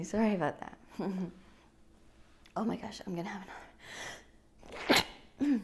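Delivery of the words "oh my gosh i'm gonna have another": real hushed whisper. Real, not acted. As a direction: whisper it under your breath. Fighting another cough.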